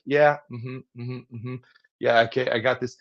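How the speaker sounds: absent-minded mm-hmms; passive hearing. Shown as a demonstration. Speaker showing half-hearted listening noises. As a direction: nod along without really listening. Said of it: "hearing is more of a passive".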